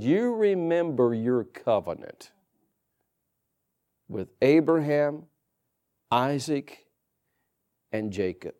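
low, slow, soft reminder; a hushed low appeal; Moses reasoning softly. Retold or imitated. Moses reminds God of covenant.

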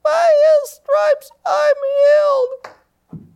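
very high, slow, scratchy squeak; little scratchy, tiny voice. Retold or imitated. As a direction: imitate a tiny, pathetic inner voice. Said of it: "little scratchy, tiny voice inside of me".

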